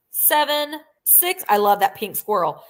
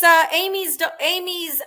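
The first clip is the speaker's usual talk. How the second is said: ordinary delivery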